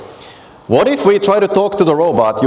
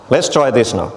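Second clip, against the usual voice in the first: usual speaking voice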